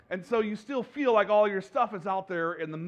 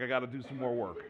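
low, soft inner grumble, much quieter; muttering to himself; 7 semitones lower, 6 dB quieter than usual. Retold or imitated. Pastor grumbling at a broken screen, soft mutter. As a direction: mutter it under your breath. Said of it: "I was like".

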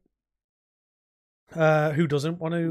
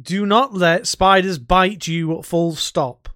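slow, loud "Do not let spiders bite you"; stern warning; 2 semitones higher, 7 dB louder, much slower than usual. Real, not acted. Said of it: "stern warning to listeners".

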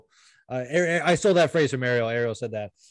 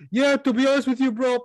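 high mock voice; 12 semitones higher, 5 dB louder than usual. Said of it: "he goes".

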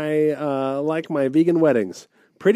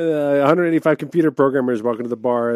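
ordinary delivery

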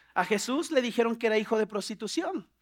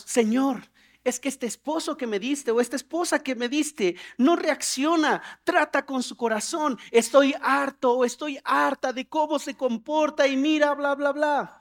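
higher-pitched acted grumbling to God; mock exasperated complaint; complaining prayer; 4 semitones higher than usual. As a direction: complain as if fed up with your spouse. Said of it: "nos quejamos".

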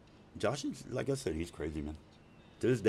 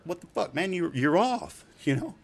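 high-pitched, exasperated objection; baffled protest; 8 semitones higher, 4 dB louder than usual. Retold or imitated. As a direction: imitate a friend protesting in disbelief. Voicing a protest to workaholic friend.

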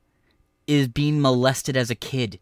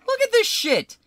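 outraged shout. Real, not acted. Yelling at clip.

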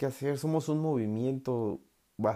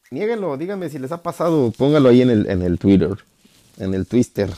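emocionado; 3 semitones higher, 12 dB louder than usual. Real, not acted. Pide comentarios, sube la voz.

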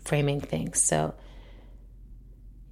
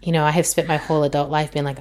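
ordinary talk

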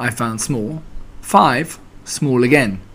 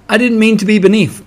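high, louder, mildly plaintive; sad; 8 semitones higher, 5 dB louder than usual. A narrator's reading. Piglet to Pooh who fell on him, sad. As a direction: protest plaintively in a little high voice. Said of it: "said Pig sadly".

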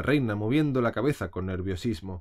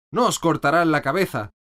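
firm reassurance; 6 semitones higher, 7 dB louder than usual. A narrator's reading. Alice reassures gardeners.

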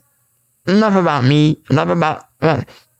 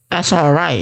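usual speaking voice

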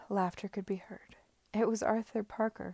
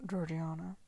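warning tone; 3 semitones lower, 3 dB quieter than usual. Acted. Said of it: "said Sidney in a warning tone".

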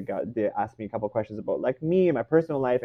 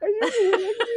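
much higher, a laughing teary squeal; crying in disbelief. Retold or imitated. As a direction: squeak it out through happy tears. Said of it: "I start crying".

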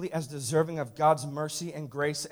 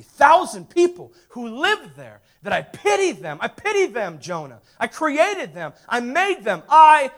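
God pleading; 7 semitones higher, 12 dB louder, slower than usual. Acted. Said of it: "he says".

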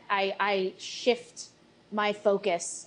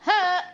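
triumphant shout; 10 semitones higher, 8 dB louder than usual. Real, not acted. Player cheers attack roll.

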